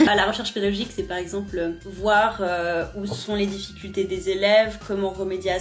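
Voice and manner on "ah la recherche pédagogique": laughing. Real, not acted. Laughing at the question.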